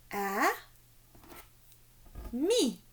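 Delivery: A, mi said apart with mi rising; syllables split. Shown as a demonstration. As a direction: beat out A then mi. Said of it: "combien de syllabes". (syllabes séparées : A... mi)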